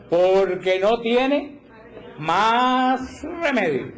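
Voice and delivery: slow, high put-upon sigh of a voice; a weary, hammed-up grumble of defeat; mock resigned whine. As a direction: drag it out like someone who has given up. Mocking the resigned person.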